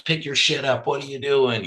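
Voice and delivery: high-pitched exasperated parent snapping; mock telling-off, pitched well up; retold scolding. Father telling three-year-olds to pick up, exasperated and high.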